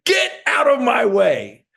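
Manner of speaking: fired-up battle cry at debt; determined shout. Shown as a demonstration. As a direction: roar it like nothing will stop you. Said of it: "That's some powerful stuff".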